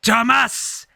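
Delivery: high shouted French Canadian call; French Canadian accent. Retold or imitated. Quoting a famous reference, shouted French Canadian accent. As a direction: yell it like a Quebec hockey player. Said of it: "in my French Canadian accent".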